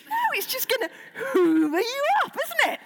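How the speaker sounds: hammed-up high-pitched protest; mock alarm. Child arguing that the shark will swallow them, mock-alarmed. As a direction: wail it in comic alarm, voice leaping high.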